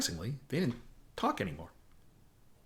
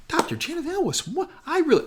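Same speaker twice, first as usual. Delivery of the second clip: gushing admirer; 4 dB louder than usual. Retold or imitated. Mary Tyler Moore gushing.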